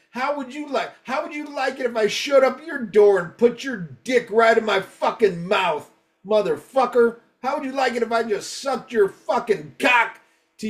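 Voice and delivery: high-pitched mock tirade; retold brutal threat. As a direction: rant like a furious fan, hammed up and pitched high. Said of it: "that was the most brutal one".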